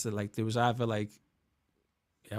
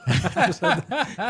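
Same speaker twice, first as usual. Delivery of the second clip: a short burst of real laughter, louder; 8 semitones higher, 5 dB louder than usual. Real, not acted. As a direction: laugh out loud at the memory. Laughing at own story.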